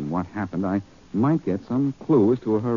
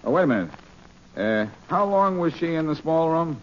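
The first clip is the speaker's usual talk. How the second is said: higher, put-on gruff police voice on the phone; brusque impostor cop, pitched up; posing as Faraday; 7 semitones higher than usual. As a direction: pass yourself off as the inspector. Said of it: "This is Inspector Faraday of the police".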